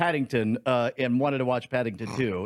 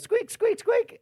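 much higher, squeaky toy noises; high squeak imitation; slower than usual. Retelling the daughter's squeaky head noise, high and squeaky. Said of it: "and Bonnie goes".

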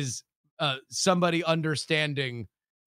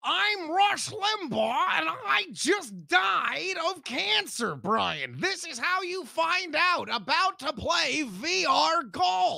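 severed head voice; 10 semitones higher, slower than usual. Acted. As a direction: boom it out like a ghostly head. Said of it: "the disembodied head says".

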